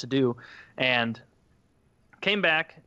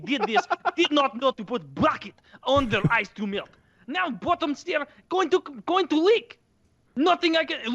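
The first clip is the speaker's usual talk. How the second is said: put-on much higher, fretting Eastern European accent; the Bosnian repairman upset at the damage; agitated Eastern European accent; 9 semitones higher than usual. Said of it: "that Eastern European accent".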